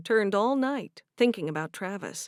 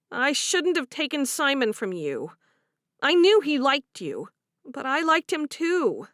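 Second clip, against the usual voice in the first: higher, sheepish confession; confessing young wife; 5 semitones higher, 5 dB louder than usual. Acted. Favor apologising about Simon, higher and guilty. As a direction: admit it to your friend. Voiced character dialogue.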